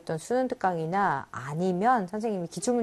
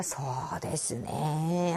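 long, slow, drawn-out stalling; stalling hesitation; 5 semitones lower, 6 dB quieter, much slower than usual. Shown as a demonstration. A Japanese speaker stalling, drawled very slowly. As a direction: drag it out to buy time. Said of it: "하면서 시간을 끌던가".

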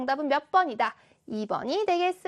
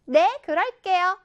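put-on loud, higher-pitched confident child's answer; 자신감 넘치는 대답; 4 semitones higher, 5 dB louder than usual. Shown as a demonstration. Teacher shows the unfitting answer to a homeroom teacher, loud and confident. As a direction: answer brightly and boldly, full of confidence. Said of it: "아까 이 상황에서처럼".

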